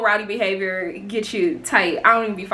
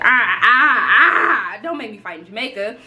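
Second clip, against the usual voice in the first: mock whimper; 4 dB louder than usual. Mock panic about fighting.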